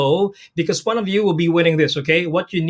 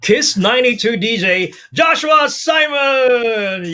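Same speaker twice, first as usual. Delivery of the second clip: slow, hyped-up announcer intro; 4 semitones higher, slower than usual. Acted. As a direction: introduce him like a ring announcer. Announcing a guest DJ.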